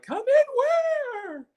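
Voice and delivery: put-on high, slow baffled old man; hammed-up high old man voice; mock old man. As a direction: drag it out like a lost grandpa.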